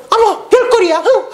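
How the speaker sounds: hammed-up indignant lady snorting and leaving; offended woman. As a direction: flounce off with a scornful huff. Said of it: "다른 사람 같으면".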